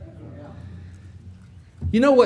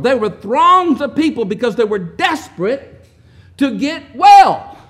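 building preaching; 3 semitones higher, 6 dB louder than usual. Real, not acted. Preacher stirring the congregation about Jesus' healings, rising fervor. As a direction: build the crowd up with each line.

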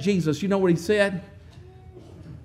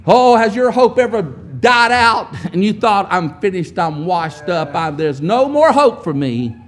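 slow fervent preaching; 5 dB louder than usual. Real, not acted.

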